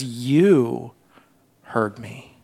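YOU drawn low, then a soft pause; personal, hushed prayer. Acted. Preacher as Jonah turning from telling to addressing God, hushed. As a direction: drop YOU low and let "heard me" fade almost away. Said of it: "he begins to personalize it".

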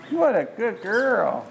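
slow, high, gushing praise; slow loving dog praise; drawn-out praise. Real, not acted. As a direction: stretch out the praise.

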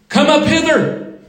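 high, ringing call; heavenly summons. Acted. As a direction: call out from above, summoning the saints. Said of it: "and we hear".

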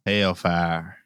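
soft, exaggerated Southern hell fire; Southern accent. Shown as a demonstration. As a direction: stretch it out in deep-South style. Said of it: "but in a Southern accent".

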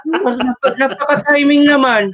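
lightly voiced mother, pitched high; a hint of a nagging mom; mom's voice up high, teasing; mother's high voice. Mother voiced in retold call.

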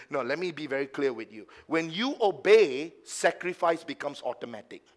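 ordinary talk

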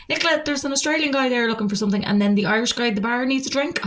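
high, quick boss voice; imitated boss. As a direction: rattle it off high, pointedly calling staff over. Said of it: "She was like".

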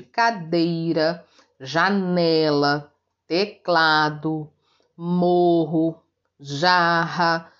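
"cadeira, janela, teclado, morro, jarra" slowly, next-to-last syllable held; teacher's measured list of paroxytone examples; penultimate stress. Shown as a demonstration. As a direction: stretch the strong syllable in each listed word. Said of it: "penúltima sílaba é a forte".

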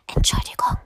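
hushed, voiceless apology; giant's whisper. A narrator's reading. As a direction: whisper it shyly through the window. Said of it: "flüsterte der Riese".

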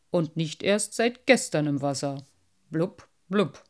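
mocking old carp with burbling blubs; a know-it-all fish looking down on the collection. Carp speaks.

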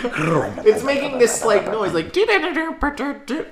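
high laughing imitation of a sputtering car; mock engine noise. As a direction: squeal the car noise, cracking up. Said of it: "You sound like an Amco commercial".

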